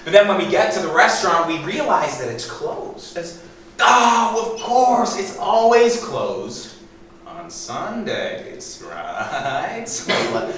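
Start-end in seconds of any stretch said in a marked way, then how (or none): 3.8-9.8 feigned surprise. Acted. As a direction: act caught off guard, drawing out the words. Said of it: "I'll feign surprise".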